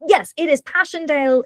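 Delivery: ordinary talk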